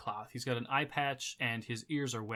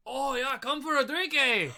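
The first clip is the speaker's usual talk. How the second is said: much higher, loud character voice; tavern patron hollering; 12 semitones higher, 7 dB louder than usual. Acted. Dark-skinned man calls newcomers over for a drink, hollering. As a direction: holler a hearty invitation across the bar. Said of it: "he says".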